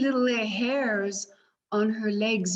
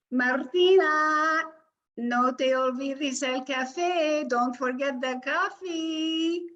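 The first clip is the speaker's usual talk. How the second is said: grandmother calling; 6 semitones higher than usual. Acted. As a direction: call down from the balcony. Grandmother calls from balcony.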